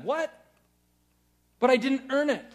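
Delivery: high, startled What, then protest; surprised protest. Pretend. Winner handed an unearned gold medal, startled protest. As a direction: blurt it out like an undeserving winner. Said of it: "You're gonna be like".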